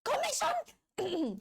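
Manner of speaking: high, drawn-out squeal of the word; real thrilled cry; excited shout. Real, not acted. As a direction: shriek it in delight. Spots a commission in the files.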